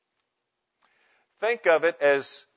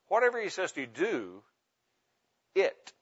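"it" set apart after a pause, pitched up; stress on it; 3 semitones higher, 3 dB quieter than usual. Shown as a demonstration. Preacher giving the verse a last flavor, stress on it. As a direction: hold back, then place "it" by itself. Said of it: "emphasizing one word at a time".

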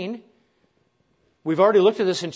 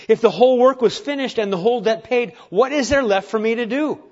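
a hint of an eager young boy, louder; young boy asking; 6 semitones higher, 6 dB louder than usual. Preacher voicing the young boy's question, lightly excited.

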